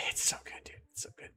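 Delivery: soft breathy whisper of delight. Real, not acted. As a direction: whisper it like a treat. Savouring the ice cream memory.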